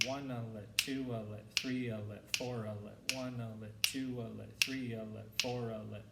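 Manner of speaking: counting triplets with the beat numbers leaned on; stress on each count. Shown as a demonstration. Band teacher counting triplets aloud for students, stressing each beat. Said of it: "I say the first beep of each set of three".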